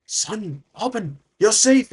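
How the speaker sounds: slow, stunned relief at finding your son; father overcome, voice lifted high; astonished father. Acted. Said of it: "his father, his eyes wide open".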